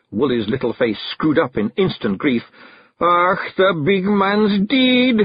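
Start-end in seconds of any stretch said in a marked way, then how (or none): 3.0-5.2 high, slow Scots lament; mock sobbing. A narrator's reading. Daft Woolly believes Rob is dead, hammed-up sobbing. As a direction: wail the bad news, drawn out. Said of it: "he sobbed".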